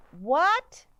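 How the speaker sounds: high, loud call; yelled. Acted. Boy yelling to the conductor on the train. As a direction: shout it after the moving train. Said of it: "I yelled out".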